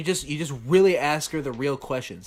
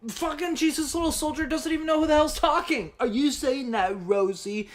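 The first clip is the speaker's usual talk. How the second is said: mock high voice; 11 semitones higher than usual. Mocking the caller's voice.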